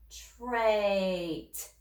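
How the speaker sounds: trait drawn out slowly, sound by sound; slow stretched blending. Shown as a demonstration. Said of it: "Say it fast".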